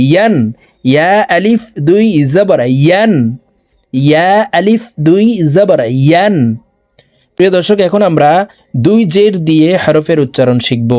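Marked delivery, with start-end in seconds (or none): 0.1-6.4 "ইয়ান" with a clear Y glide; mid-tongue Ya. Shown as a demonstration. Teacher drills the correct reading, clear mid-tongue Ya. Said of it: "বলতে হবে".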